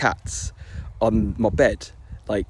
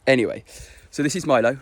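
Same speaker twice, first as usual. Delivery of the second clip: usual speaking voice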